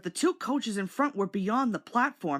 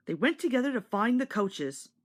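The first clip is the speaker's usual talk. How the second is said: ordinary delivery, nothing marked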